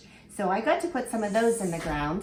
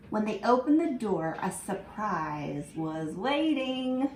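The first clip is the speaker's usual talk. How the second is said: slow, drawn-out story time reading toward the happy ending; storybook reading, building wonder; 2 semitones higher than usual. Acted. Teacher reading the ending to her class, slow and wondering. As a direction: savour each line as the flower finally grows.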